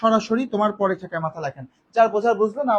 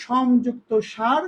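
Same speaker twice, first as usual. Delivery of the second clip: split word slowly; much slower than usual. Shown as a demonstration. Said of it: "সংসার শব্দটাকে যখন আমরা সন্ধির নিয়মে ভাঙলাম".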